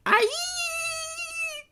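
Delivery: long shriek of pain; very high drawn-out scream; high-pitched shriek. Acted. Bitten woman shrieking in pain. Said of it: "shrieked one of the women".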